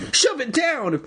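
put-on squeaky urgent girl; high girl voice. A narrator's reading. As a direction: bark the order in a high voice. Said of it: "Andy instructed".

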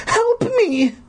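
put-on very high little-girl cry for help; terrified cry. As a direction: pitch the plea way up in panic. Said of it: "the girl's terrified cries".

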